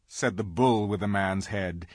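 ordinary delivery

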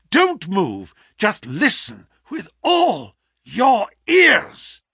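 high, loud, emphatic orders; urgent, masterful command. A narrator's reading. Sick detective hustles his friend into hiding, urgently commanding. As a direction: drive each order home with sudden strength. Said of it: "his masterful, purposeful talk".

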